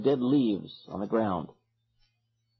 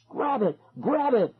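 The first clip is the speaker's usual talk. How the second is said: put-on boy wailing; 11 semitones higher than usual. A narrator's reading. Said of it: "a boy wailed".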